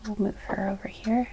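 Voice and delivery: soft unvoiced whisper. Real, not acted. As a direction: whisper it under your breath. Quietly placing cards during reading.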